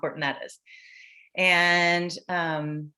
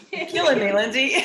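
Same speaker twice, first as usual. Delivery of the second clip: laughing; 12 semitones higher than usual. Real, not acted. Moved host laughs at tearful speech.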